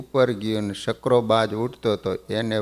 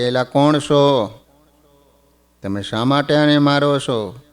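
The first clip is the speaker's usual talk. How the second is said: a raised, commanding call; mild mock challenge to the robbers; retold shout; 3 semitones higher, 5 dB louder than usual. The Lord calling out to the robbers, a mild raised shout. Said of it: "જોરથી બૂમ પાડી કે".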